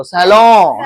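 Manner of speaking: सैलून as "sa-law" ending in the nose; open, nasalised last vowel on सैलून; nasal ending. Shown as a demonstration. Teacher has class stress the nose, nasal ending. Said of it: "तो नाक पे जोर दो".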